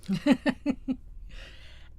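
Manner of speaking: real wordless chuckle to oneself. Real, not acted. Vlogger moving on to the next special, chuckling. Amused before next pick.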